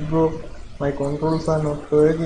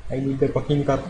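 normal talking voice

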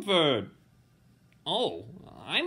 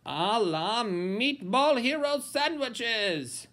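ordinary delivery

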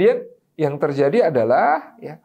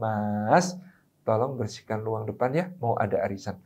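softer and lower, tender and unhurried; gentle request; 6 semitones lower, 8 dB quieter than usual. Shown as a demonstration. Wife asks husband gently sitting knee to knee, soft and low. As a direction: ask him sweetly while sitting close. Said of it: "Cara menyuruh laki-laki itu nggak begitu".

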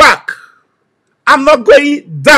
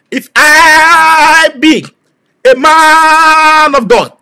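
slow solemn oath; 3 semitones higher, much slower than usual. Real, not acted. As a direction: swear it slowly before God.

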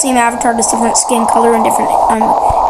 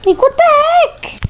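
high, slow disbelief; 13 semitones higher, slower than usual. Real, not acted. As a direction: squeal it slowly in disbelief. Disbelief after dying.

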